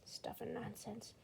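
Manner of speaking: quiet disdainful sniff. Acted. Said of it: "he sniffed".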